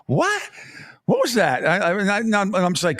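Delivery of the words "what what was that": surprised. Retold or imitated. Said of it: "I used to shake my head and go".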